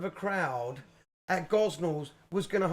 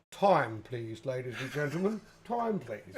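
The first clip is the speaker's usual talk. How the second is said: low-pitched bar manager announcement; imitated manager's last-orders voice; PA closing-time call; 7 semitones lower, 3 dB quieter, slower than usual. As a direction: call time over the pub PA. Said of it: "Rod would get on the P A and he'd say".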